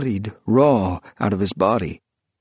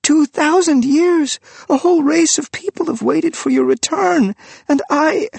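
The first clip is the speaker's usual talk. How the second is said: desperate outburst; 6 dB louder than usual. Acted. Priest begging the returned figure to understand, rising in desperation. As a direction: pour it out, rising to a cry.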